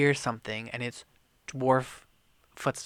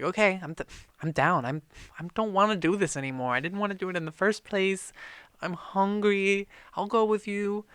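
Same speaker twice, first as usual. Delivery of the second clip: higher, whiny pleading voice; whiny donkey; 6 semitones higher, 3 dB louder, faster than usual. Retold or imitated. Captured donkey giving in, high and whiny. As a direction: beg like a scared donkey.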